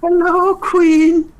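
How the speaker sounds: very high, squeaky fairy creature; high fantasy insect voice. Acted. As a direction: squeak like a tiny winged creature. Eighties fantasy scene, insect creature.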